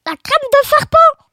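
high, loud shocked snake; serpent horrifié. Acted. Snake aghast at snake-cream dish. (exclamation horrifiée, aiguë)

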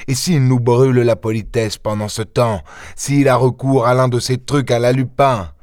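itching to pounce, hurry the chief; impatient Ganimard. Acted. Ganimard urges his chief to strike at once, impatient.

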